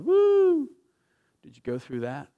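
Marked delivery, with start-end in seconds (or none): 0.0-0.7 a high, loud mocking woo; mock cheer. Mocking a careless believer, a high loud whoop. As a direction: whoop like a shallow fan.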